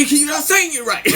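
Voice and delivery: high retort dissolving into laughter; laughing exasperation. Retold or imitated. Correcting the cellmate, high laughing exasperation. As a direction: protest high, cracking up.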